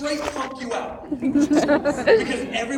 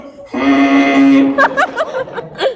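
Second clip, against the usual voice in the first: long, loud, low horn blast; sustained honk imitating a horn; imitated air horn; 14 semitones lower, 11 dB louder than usual. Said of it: "they brought an air horn with them".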